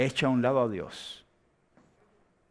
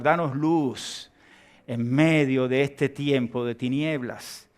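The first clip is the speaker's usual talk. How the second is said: oración lenta y sentida, con énfasis creciente; oración final; 3 semitones higher, 5 dB louder than usual. Real, not acted. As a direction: ora pausado, sube con fuerza y baja hacia el amén. Leads closing prayer. (oración ferviente y pausada)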